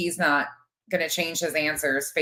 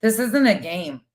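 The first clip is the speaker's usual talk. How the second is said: raised, heated indignation; indignant outburst; 4 semitones higher, 4 dB louder than usual. Real, not acted. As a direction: start high and loud, then press the point. Outburst in a live argument.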